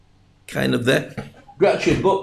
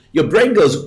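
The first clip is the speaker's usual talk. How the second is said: ordinary talk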